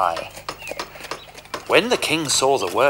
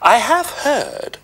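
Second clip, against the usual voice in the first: much higher, bright cat voice; Puss the cat; 13 semitones higher, 5 dB louder than usual. Acted. Puss baiting the ogre, high coaxing voice. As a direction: coax the ogre along.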